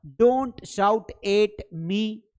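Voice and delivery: "Don't shout एट मी" spelled out slowly, word by word; slow modelling. Shown as a demonstration. Teacher to pupils, modelling the English phrase slowly and clearly. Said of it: "मुझ पर मत चिल्लाओ".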